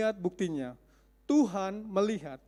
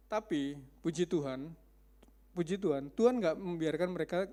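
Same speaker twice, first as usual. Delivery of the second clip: normal talking voice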